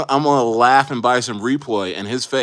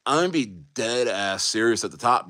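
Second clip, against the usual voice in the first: ordinary talk, nothing marked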